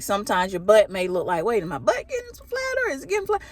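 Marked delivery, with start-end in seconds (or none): 1.4-3.4 high-pitched, disbelieving squeal of a question; mock alarm. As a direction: squeak it in pretend dismay. Acting out alarm at the mirror.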